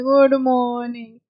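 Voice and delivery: slow, low mock sleepy greeting; sleepy good morning. Teacher mimicking students' sleepy good morning. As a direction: drag it like it's eleven at night. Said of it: "आपका ऐसा लग रहा है रात के ग्यारह बज रहे हैं".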